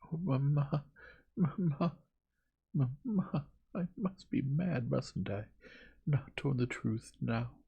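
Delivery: high, slow cries of despair; implore, lingering on each cry; distraught pleading. A narrator's reading. Daughter kneeling at her mother's feet, distraught. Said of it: "fell on her knees at her mother's feet".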